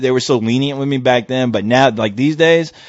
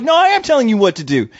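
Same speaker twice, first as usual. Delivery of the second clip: higher-pitched acted argument; retold defiant comeback; 8 semitones higher than usual. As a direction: push back as if mid-quarrel. Acted quarrel, speaker's side.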